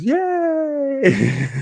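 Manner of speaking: mock cheer. Streamer cheering his subscriber count with a squeaky mock yay. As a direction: cheer it like a tiny celebration.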